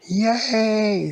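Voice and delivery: high, loud delighted yay; joyful cheer at finding adventure; excited cheer. Acted. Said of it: "said Elvie".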